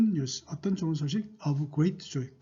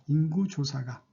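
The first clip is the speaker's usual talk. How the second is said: ordinary talk, nothing marked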